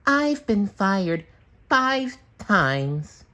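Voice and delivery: high, slow, dejected sponge voice; sighing SpongeBob. A narrator's reading. SpongeBob telling Gary he was fired, high and dejected. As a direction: droop through it in a high cartoon voice. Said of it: "he sighed".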